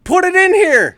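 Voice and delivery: high, loud shouted demand; exasperated yell. Real, not acted. Co-host scolding over show prep, yelling.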